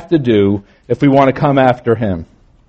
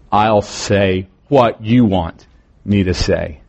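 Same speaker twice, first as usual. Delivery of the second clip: very slow, YOU drawn out, pause before me to say; stress on YOU; 4 semitones lower, slower than usual. Shown as a demonstration. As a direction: lean deliberately on you want. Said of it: "that's where the emphasis becomes".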